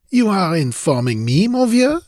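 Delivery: light smiling French voice; amused Frenchman. Acted. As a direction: say it with a wry smile. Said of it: "answered with a smile".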